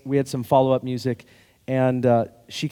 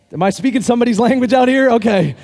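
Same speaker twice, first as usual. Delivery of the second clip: laughing through the question to the crowd; chuckling as you ask; laughing aside; 8 semitones higher, 6 dB louder than usual. Real, not acted. Pastor laughing with the congregation, playful.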